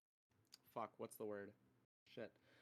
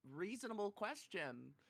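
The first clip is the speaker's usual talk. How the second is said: put-on higher-pitched, in the girl's defence; mock incredulous; 7 semitones higher than usual. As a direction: argue it like an indignant kid. Arguing the girl's side, pitched up.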